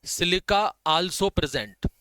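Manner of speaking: very slow, word by word; slow dictation. Shown as a demonstration. Teacher dictating an exam point, very slow. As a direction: dictate slowly so they can copy. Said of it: "इससे भी question आते हैं".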